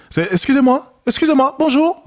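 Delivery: jumps way up into a squeaky high greeting; imitating a nervous guy's high-pitched voice break; mock voice crack. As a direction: mimic his voice flipping high mid-approach. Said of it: "La transition dans sa voix".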